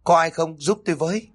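high, urgent cry for help; calling for help. Acted. Daughter-in-law calling out for help, high and urgent. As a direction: call out to anyone nearby, panicked.

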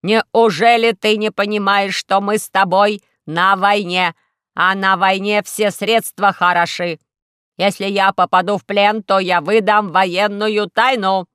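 slow, loud scolding robot voice; a robot hotly lecturing about war; slow, loud robot reproaching Alice; angry robot. Acted.

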